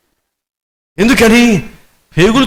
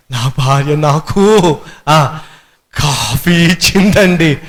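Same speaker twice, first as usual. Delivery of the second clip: choked-up husband; 3 semitones lower, slower than usual. Acted. Husband overwhelmed, quoted.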